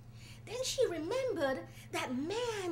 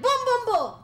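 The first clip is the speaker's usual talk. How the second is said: loud knocking; 8 semitones higher, 13 dB louder than usual. Acted. Knocking on God's door early in the morning, loud pounding.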